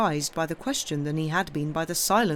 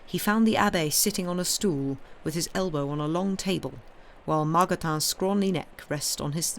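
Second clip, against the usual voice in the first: usual speaking voice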